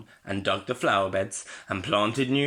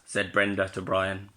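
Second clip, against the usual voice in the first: plain reading voice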